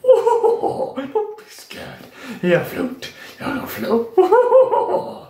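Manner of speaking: high, drawn-out creepy clown impression; Stimmimitation. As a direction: stretch the words out in a high put-on voice with laughter. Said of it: "so that one isn't perfect".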